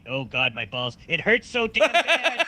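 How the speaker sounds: pained laughing whine. Acted. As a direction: groan the pain while cracking up. Opie in pain after rat bite.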